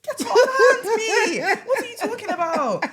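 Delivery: very high, loud, drawn-out protest; slow, shrieked comic indignation; outraged mock shout. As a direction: yell it in hammed-up outrage through laughter. Acted outraged reply in retold quarrel.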